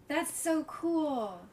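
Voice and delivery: slow, breathless gasp of wonder; drawn-out amazed gasp; awed gasp. Real, not acted. Awed reaction to Shakespeare news.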